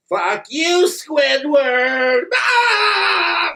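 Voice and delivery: high cartoon sponge taunt; high-pitched, cussing cartoon sponge; SpongeBob. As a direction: squeal it high, ending in a shriek. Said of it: "when I start doing SpongeBob's voice".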